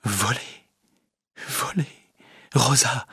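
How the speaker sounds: soft, halting murmur; stunned murmur. A narrator's reading. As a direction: murmur it in shock, barely voiced. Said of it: "murmurant seulement". (murmure abasourdi)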